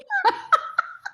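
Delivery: a high squealing laugh at herself. Real, not acted. Laughing at her own story to the host, high giggle.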